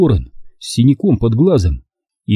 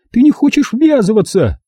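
very high, mild yell; mother yelling; 12 semitones higher than usual. A narrator's reading. Mother outraged that son stays out, mild yell. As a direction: throw it back in a raised pitch. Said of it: "закричала мама".

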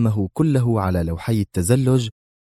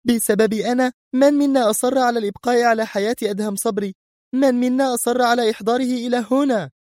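high, indignant questioning; Sonia angry retort. Acted. Sonia angrily turns the blame back on Mr X, high. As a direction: throw the blame back at him. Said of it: "صاحت في غضب".

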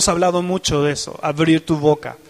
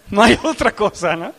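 ordinary talk, nothing marked

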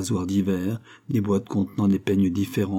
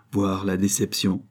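usual speaking voice

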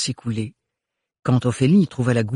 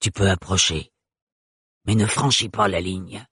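put-on low guttural old woman's voice; guttural murmur; 4 semitones lower than usual. A narrator's reading. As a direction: keep it low, in the throat. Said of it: "murmura la voix gutturale".

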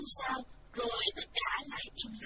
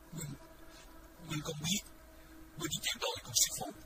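choked up; 5 dB quieter than usual. A narrator's reading. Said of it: "nghẹn ngào nói".

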